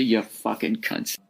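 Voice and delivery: low, slow grumble under breath; muttered insult. Real, not acted. Muttered insult after surrender.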